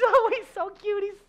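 put-on high, dreamy girlish voice; gushing infatuated girl. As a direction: gush like a smitten bride.

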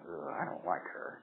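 soft low disgusted ugh; disgusted mutter. Retold or imitated. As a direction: sneer it quietly. Voicing instant dislike.

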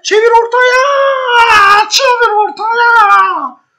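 high, loud, slow stretched-out yell; long desperate shout. Real, not acted. Manager yelling at his player to switch it into the middle, stretched loud shout. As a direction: bellow the order across the pitch.

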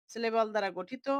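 usual speaking voice

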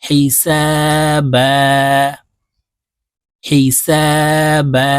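হিসাবা with the last বা stretched very long; হিসাবা, slow, with the end held; long final alif. Shown as a demonstration. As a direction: draw out the final vowel of হিসাবা for one alif. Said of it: "এক আলিফ টেনে বলবো".

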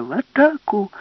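battle cry. Acted. Alice rallies the professor to charge, loud high cry. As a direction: lead the charge.

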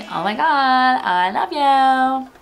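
put-on slow, loud, high American accent gushing thanks. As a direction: gush it like an over-the-top American tourist. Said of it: "That's my American accent".

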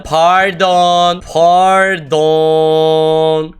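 long, stretched "Pardon"; drawn-out pardon. Pretend. Player apologising for the mistake, exaggerated drawn-out "Pardon". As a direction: drag out both syllables of "Pardon". Repeats it, dragged out.

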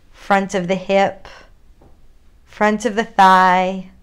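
unhurried guided yoga cues with long pauses; calm grounding exercise. Acted. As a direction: lead them gently into their body.

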